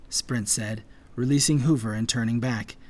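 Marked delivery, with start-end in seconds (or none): none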